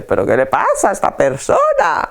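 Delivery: acted indignant complaint; baffled, irritated outburst, played up; growing anger. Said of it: "se va enfadando más y dice". (indignación creciente, actuada)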